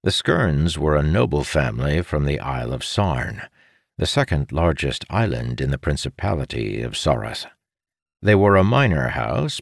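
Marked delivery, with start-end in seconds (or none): none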